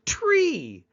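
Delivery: very high, loud echo; child echoing back. Acted. Other child nods and repeats the word, high childlike echo. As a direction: squeal it back like an eager pupil. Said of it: "nods and goes".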